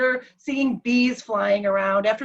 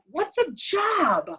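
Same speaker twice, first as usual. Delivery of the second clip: slow, higher, puzzled retelling; baffled question; 7 semitones higher, much slower than usual. Retold or imitated. Confused husband asking wife about jobs, baffled question. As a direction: stretch it out, utterly puzzled. Husband does not know the word.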